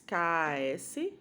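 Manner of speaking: letters spelt out slowly, "K A S"; slow spelling. Shown as a demonstration. (soletrando "K A S" bem devagar)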